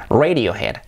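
"Radiohead" as REY-dio-hed; English-style RAY-dio-head; English pronunciation. Shown as a demonstration. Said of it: "el nombre sería".

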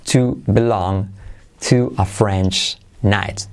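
slow "to belong", pause, "to a French knight"; careful, drawn-out demonstration; slow model. Shown as a demonstration. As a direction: space the phrase out for learners. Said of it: "Como bien usted".

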